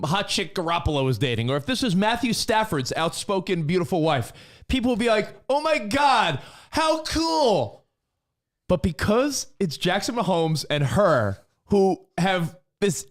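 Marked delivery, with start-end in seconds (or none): 5.5-7.8 high-pitched mock gushing; playfully overexcited, pitched up; gushing fan. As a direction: gush like an impressed fan. Imagined gushing fans' reaction.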